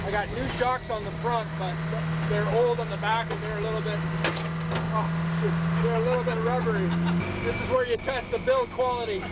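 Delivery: talking while bouncing in the cab; on a bumpy road. Real, not acted. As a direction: voice jolted by road bumps. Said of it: "this road was so bumpy".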